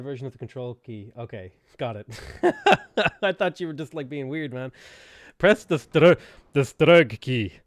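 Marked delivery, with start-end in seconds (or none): 2.1-3.2 loud, high laugh; burst of laughter. Real, not acted. Laughs at chat's German key. 5.4-7.5 very loud, slowed-down order; mock command. Mock German command after key joke.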